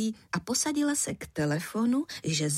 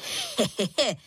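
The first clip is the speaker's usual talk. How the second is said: acted short boyish chuckle; pleased little laugh. A narrator's reading. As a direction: laugh briefly in character. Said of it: "Zasmál se".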